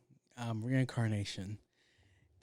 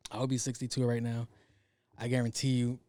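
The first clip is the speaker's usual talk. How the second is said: ordinary talk, nothing marked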